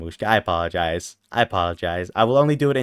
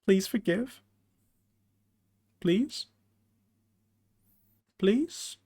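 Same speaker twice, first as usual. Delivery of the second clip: tiny high plea; 7 semitones higher, 11 dB quieter, much slower than usual. Pretend. Tiny pleading voice.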